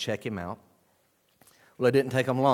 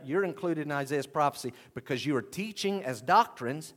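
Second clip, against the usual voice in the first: ordinary delivery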